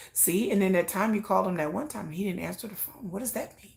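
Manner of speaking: hushed, voice dropping to a whisper; scheming whisper. Pretend. Her doubts egging her on in bed, a hushed whisper. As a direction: whisper it like gossip.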